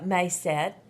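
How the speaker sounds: ordinary delivery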